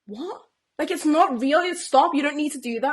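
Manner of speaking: imitating the husband snapping at her; mock shout. Husband yelling at nanny.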